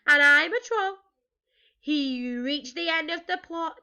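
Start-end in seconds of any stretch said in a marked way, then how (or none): 0.1-1.0 high-pitched troll protest; small voice pushed out loud. A narrator's reading. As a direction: squeak it, but loudly. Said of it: "in a very small voice".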